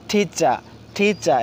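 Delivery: "টিচার" slowly, aspirated ঠ and no final R; silent R. Shown as a demonstration. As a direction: drill "টিচার" as thi-cha, slow, R left silent. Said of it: "আর উচ্চারিত হয় নাই".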